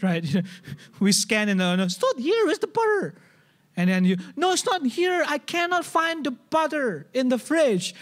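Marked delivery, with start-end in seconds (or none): 1.8-3.2 higher, insistent complaining husband who can't find it; frustrated husband. Retold or imitated. Husband at the fridge whining to his wife, higher and insistent. 4.3-7.9 higher, insistent complaining husband who can't find it; frustrated husband. Retold or imitated. Husband at the fridge whining to his wife, higher and insistent.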